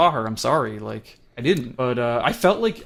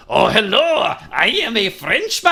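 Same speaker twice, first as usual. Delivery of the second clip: high, louder mock French accent; bad French accent; 8 semitones higher, 4 dB louder than usual. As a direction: ham up a cheesy French accent. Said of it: "doing a really bad French accent".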